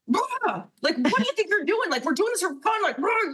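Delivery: high exasperated callout; calling out a player. Retold or imitated. Player scolding a rival who cheated her in a game. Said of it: "I emote and I'm like".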